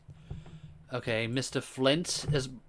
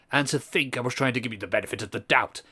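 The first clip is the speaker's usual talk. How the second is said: posh voice; 3 semitones lower than usual. Acted. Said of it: "so he's gonna stay with the posh".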